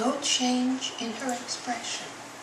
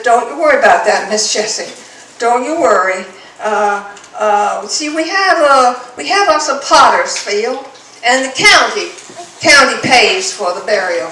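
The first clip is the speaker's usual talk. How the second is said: loud, hearty sheriff soothing her; loud reassurance; 17 dB louder than usual. Acted. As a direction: reassure her firmly and loudly. Sheriff reassures grieving mother.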